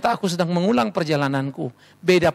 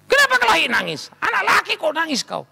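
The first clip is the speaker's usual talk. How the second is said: high, indignant rebuke; acted fatherly telling-off, pitched up; scolding father; 10 semitones higher than usual. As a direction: berate the boy for crying. Acting father scolding crying son.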